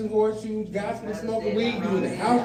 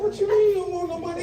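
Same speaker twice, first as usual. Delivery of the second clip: high, fast disbelief; squeaky fast indignant question; mock outrage; 10 semitones higher, faster than usual. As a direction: fire it back, incredulous. Grandpa retorts in disbelief.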